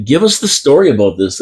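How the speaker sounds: ordinary talk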